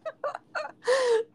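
high shrieking laugh; burst of laughter. Real, not acted. Friend's funny story.

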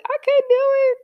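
high-pitched mock-tearful whine; retold emotional. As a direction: squeak it out as an overwhelmed kid. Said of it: "then I got emotional".

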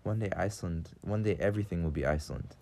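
usual speaking voice

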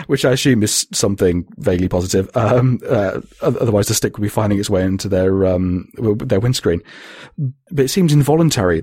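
usual speaking voice

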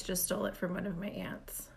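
hushed confessional whisper. Real, not acted. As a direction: say it under your breath. Confessing she finds it boring.

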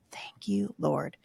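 soft thanks, falling into a whisper; hushed gratitude. Retold or imitated. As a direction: breathe a quiet prayer of thanks. Looking back in thankful prayer.

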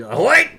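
"Hoyt" shouted high and loud as one greeting; Vermont "Hoyt". Shown as a demonstration. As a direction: call out "Hoyt" to the road crew. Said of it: "I'll just".

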